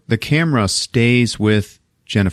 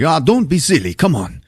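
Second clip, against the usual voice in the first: higher-pitched, hammed-up brushing off; insistent host; 7 semitones higher than usual. Host coaxes the reluctant visitor to stay, mock-effusive insistence. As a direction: imitate a host waving away objections.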